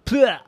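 high, sudden "pleh"; disgusted spit-out noise. Acted. Mommy spitting out the lollipop, disgusted.

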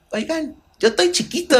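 put-on high little-kid voice; childish voice. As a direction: play the small kid whining to grown-ups. Mocking himself as a child.

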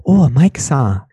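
sing-song retold doubt; doubtful Japanese students. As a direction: imitate a politely puzzled Japanese student. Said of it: "they're like".

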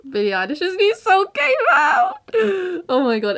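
gasping with fan excitement; overexcited fangirl. Real, not acted. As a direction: burst out with thrilled excitement. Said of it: "I'm gonna cry".

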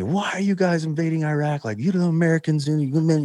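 a mild imitation of an angry soldier ranting; mock angry yelling. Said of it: "this guy starts just yelling at me".

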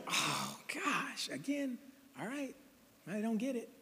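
hushed, put-upon muttering to oneself; a soft, mock-weary God giving up on them; weary exasperation. God up there hearing demands again, quietly exasperated.

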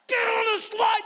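dad yelling he won't walk down; exasperated yell. Retold or imitated. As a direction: hammer each word at the kids. Dad yelling back at top.

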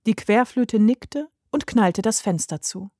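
usual speaking voice

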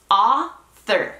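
"Author" split into AH, pause, THER; drawn-out two-part "Author"; syllable by syllable. Shown as a demonstration. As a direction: break "Author" in two, straight from th to er. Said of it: "Después del th, váyanse directo al er".

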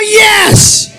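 high, very loud shouted "yes"; full shout. Shown as a demonstration. Preacher telling the congregation to shout, full-force yell.

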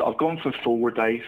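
usual speaking voice

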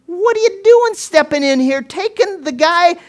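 very high, loud, indignant shouting; outraged father. Acted. Preacher as sick girl's father, outraged high shouting. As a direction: cry out in acted protest at being cut off. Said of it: "Can you imagine the mind of Jairus, this father".

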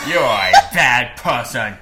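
loud goofy mock judge; booming comic verdict; Discord moderator voice. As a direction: scold the convict in a silly accent. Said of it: "sentencing John Wayne Gacy in The Voice".

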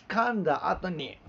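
ordinary talk, nothing marked

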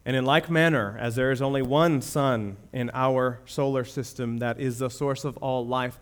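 ordinary delivery

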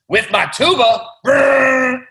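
high, loud goofy voice then a buzzing tuba blast; octopus with tuba. Acted. Octopus dries boy with tuba, loud silly brass noise. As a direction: belt it then blow a mock tuba raspberry. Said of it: "And the octopus says".

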